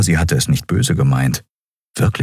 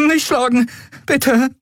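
much higher, cowering cry; frightened plea. A narrator's reading. Miko begs the raised-armed Skria not to hit him, high and scared. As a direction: shield your face and beg.

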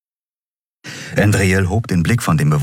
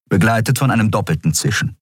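ordinary delivery

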